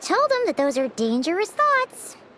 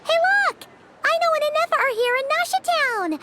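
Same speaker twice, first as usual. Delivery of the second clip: excited call; 8 semitones higher than usual. Acted. Paimon spots Aino and Inefa and calls out excitedly. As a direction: call out to friends you've just spotted.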